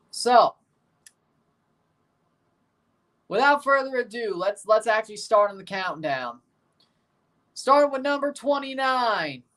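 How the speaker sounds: upbeat show host announcing; announcer voice. Acted. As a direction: hype up the countdown. Announces the countdown.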